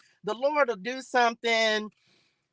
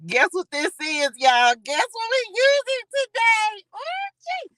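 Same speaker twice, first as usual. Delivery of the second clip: high squealing tease; excited squeal; 12 semitones higher, 3 dB louder than usual. Real, not acted. Host teases viewers about her new product, squealing high. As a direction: squeal over the reveal.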